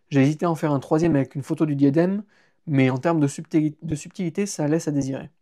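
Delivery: plain reading voice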